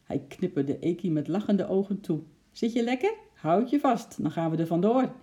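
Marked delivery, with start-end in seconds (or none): 2.5-5.2 higher, lively animal character voice; chimp's cheerful call. A narrator's reading. Chimp readying the squirrel on his shoulder, lively. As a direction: call it out to a small passenger.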